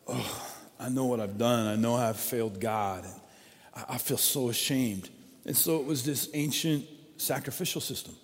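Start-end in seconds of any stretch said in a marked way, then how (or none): 0.1-5.1 ashamed confession. Retold or imitated. Voicing ashamed ancient worshipper.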